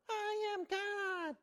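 very high, stretched-out mock yell; Shirley MacLaine. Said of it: "a little louder".

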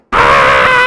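loud high crying scream; imitated screaming cry. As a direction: scream like a boy before a spanking. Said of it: "he's going".

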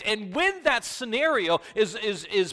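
high, loud preaching climax; full-force preaching. Acted. Pastor urging faith in Jesus, full-force preaching. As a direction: drive the gospel call home.

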